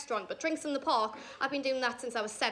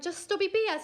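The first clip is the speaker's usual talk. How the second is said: incredulous; 6 semitones higher, 4 dB louder than usual. Retold or imitated. Disbelief at his drinks.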